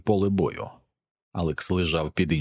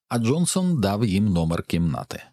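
ordinary delivery, nothing marked

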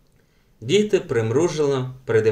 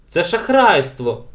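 slow, loud, higher indignation; indignant; 5 semitones higher, 5 dB louder, slower than usual. A narrator's reading. Ellen objects to the rigged game, indignant. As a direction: protest it as cheating. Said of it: "обурилася Елен".

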